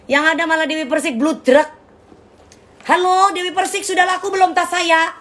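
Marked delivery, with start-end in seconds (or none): 2.8-5.1 high, sing-song imitation of a pushy seller calling; playful impression of a nagging consignor on the phone; mock phone caller. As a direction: put on a higher fake-sweet phone voice asking about her bag. Mimics a seller phoning [name].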